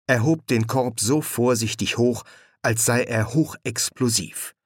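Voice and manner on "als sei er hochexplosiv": plain reading voice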